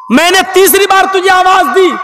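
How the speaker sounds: high-pitched, insistent reproach; angry questioning. Acted. Prophet confronting the servant over his third call, angry. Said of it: "गुस्से में आए".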